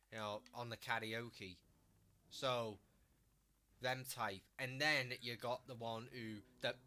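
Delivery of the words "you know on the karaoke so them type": ordinary talk, nothing marked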